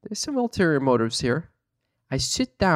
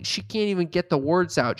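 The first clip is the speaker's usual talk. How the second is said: normal talking voice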